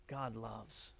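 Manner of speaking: ordinary talk, nothing marked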